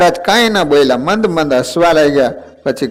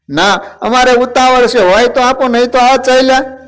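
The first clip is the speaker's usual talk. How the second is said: high, loud hurried demand; playful god in a rush; impatient insistence; 10 semitones higher, 3 dB louder than usual. Acted. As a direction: push her to hand over food now. Maharaj insists he is in a hurry.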